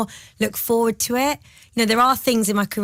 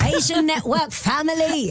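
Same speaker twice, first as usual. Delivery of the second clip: excited shout-out; 7 semitones higher than usual. Real, not acted. Guest hyping up the radio audience, excited shout. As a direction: belt out the shout-out like a hype call.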